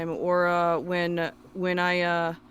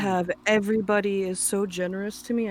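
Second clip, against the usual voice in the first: usual speaking voice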